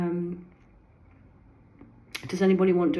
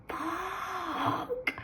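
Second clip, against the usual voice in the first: high-pitched, soft drawn-out gasp of a curse; stunned realization; 7 dB quieter than usual. Retold or imitated. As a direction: re-enact the shock in a thin, high, quiet voice. Retelling moment of stunned realization.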